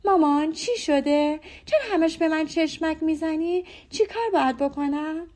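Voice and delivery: a hint of a naive daughter puzzled by the winks; innocent girl. A narrator's reading. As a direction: ask wide-eyed, all innocence. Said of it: "خیلی معصومانه گفت".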